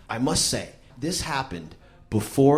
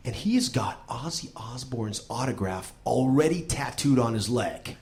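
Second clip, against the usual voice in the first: ordinary talk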